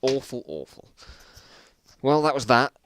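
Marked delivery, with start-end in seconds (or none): none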